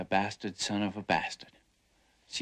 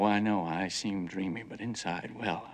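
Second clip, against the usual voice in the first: ordinary talk, nothing marked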